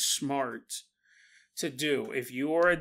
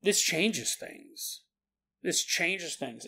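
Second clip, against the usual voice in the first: slow awed realization; 4 dB quieter, much slower than usual. Retold or imitated. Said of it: "I was like".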